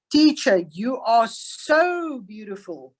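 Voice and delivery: slow, admiring read leaning on so; emphatic so. Shown as a demonstration. Teacher gives a flattering example to the class, slow and emphatic. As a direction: savour the word so. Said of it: "means she's really, really beautiful".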